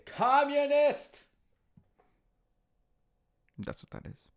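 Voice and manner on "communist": high, slow, loud sung-out word; a mock-dramatic wail on one word; drawn-out shout. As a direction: belt the word out long and high.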